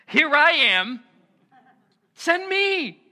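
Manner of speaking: high, loud eager volunteer; Isaiah bursting to volunteer; eager shout. Acted. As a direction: shout it like you can't wait to go.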